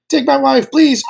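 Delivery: put-on high, old borscht belt comic; Catskills comic. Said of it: "doing an impression of Sam as a Catskills comic in his sixties".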